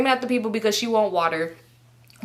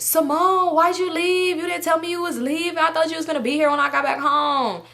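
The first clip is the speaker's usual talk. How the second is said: put-on whiny boyfriend; 8 semitones higher than usual. Boyfriend on the phone asks why she left, high mock whine. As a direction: imitate a put-out boyfriend, pitched way up.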